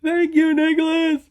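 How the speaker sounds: very high, loud gushing thanks; delighted aunt. Retold or imitated. The aunt receiving her present, gushing high and loud. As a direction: squeal the thanks like a thrilled old aunt. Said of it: "And it's like".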